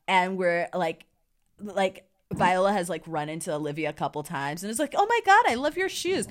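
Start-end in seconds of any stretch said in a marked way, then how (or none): 5.0-6.3 high-pitched mock gush; girly gushing. Viola as Sebastian meeting Olivia, high girly gushing. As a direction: squeal a compliment like a girly girl.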